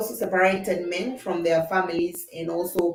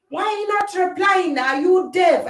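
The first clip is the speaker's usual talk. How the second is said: imitating a scold, higher and louder; mock scolding; 8 semitones higher, 6 dB louder than usual. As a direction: play the nagging accuser. Voicing scolding people in Africa.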